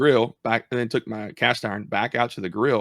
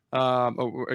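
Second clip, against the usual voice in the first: ordinary delivery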